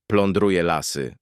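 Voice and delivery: ordinary delivery, nothing marked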